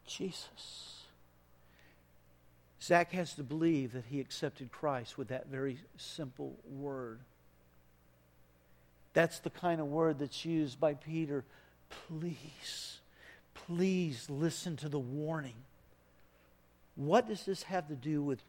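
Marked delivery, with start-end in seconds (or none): 0.1-0.9 faint breathless whisper; last whispered word of a dying father. Retold or imitated. 12.1-15.6 soft, earnest pleading; quiet heartfelt appeal; soft plea. Real, not acted. Pleading with the congregation.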